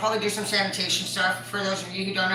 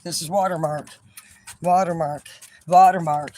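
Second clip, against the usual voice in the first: "watermark" said louder each time, falling; son repeating watermark; 7 dB louder than usual. Retold or imitated. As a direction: hammer the word watermark harder each time. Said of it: "He's like".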